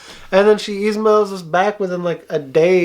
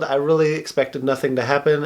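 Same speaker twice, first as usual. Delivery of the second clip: ordinary delivery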